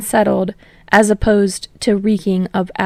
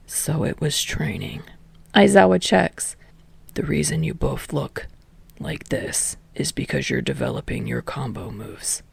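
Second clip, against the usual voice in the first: low, much softer tired voice; weary teacher; 5 semitones lower, 7 dB quieter than usual. A narrator's reading. Exhausted teacher fed up with class. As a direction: sigh it out low and worn.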